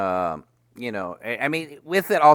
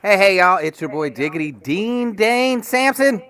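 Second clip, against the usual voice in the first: hype greeting; 7 semitones higher, 5 dB louder than usual. Real, not acted.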